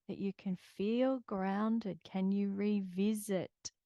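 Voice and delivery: gentle guided grounding voice; calm grounding exercise. Acted.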